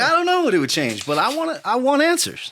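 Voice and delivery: high, whiny plea for answers; exasperated demand. Retold or imitated. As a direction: press for answers like a frustrated kid.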